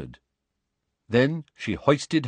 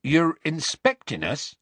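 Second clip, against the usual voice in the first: slow, higher-pitched blank question; slow, baffled question; blank echo; 8 semitones higher than usual. A narrator's reading. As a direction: echo it back, not taking it in. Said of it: "Hagrid echoed blankly".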